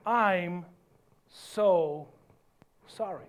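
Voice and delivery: low, slow, word-by-word apology; humble apology. Shown as a demonstration. Preacher models the apology for the congregation, slow and humble. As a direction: space out each word gently. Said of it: "What does humility sound like?".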